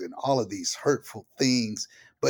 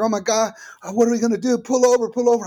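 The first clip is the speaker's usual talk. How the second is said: high, loud imitated panic; panicking wife; 11 semitones higher, 7 dB louder, much faster than usual. Husband imitating his panicking wife in the car, high and frantic. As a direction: mimic her frantic plea to pull over. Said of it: "'cause she was panicking".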